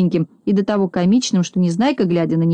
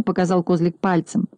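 ordinary delivery, nothing marked

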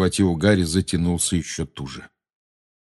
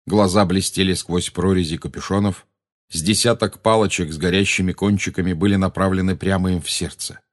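ordinary delivery

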